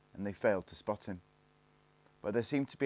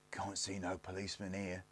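softer, understated scorn; scornful; 8 dB quieter than usual. A narrator's reading. Eldest looks round the pit, quietly scornful. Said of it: "he said scornfully".

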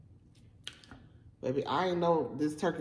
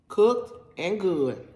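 drawn out; 2 semitones higher, 5 dB louder, much slower than usual. Real, not acted. Drawn-out praise of the meat.

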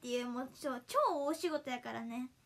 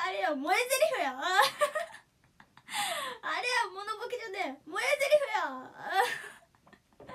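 loud, high protest breaking into laughter; laughing protest; 6 semitones higher, 4 dB louder than usual. Real, not acted. Streamer insisting it was a cute line, laughing protest. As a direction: object loudly through giggles.

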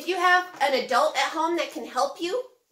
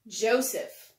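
ordinary delivery

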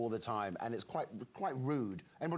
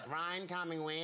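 higher broad Queensland question; queensland accent; 6 semitones higher than usual. Shown as a demonstration. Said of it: "I've got to hear it first".

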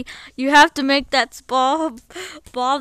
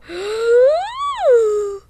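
gasp; 9 semitones higher, 5 dB louder than usual. Acted. Frightened of the coming scream.

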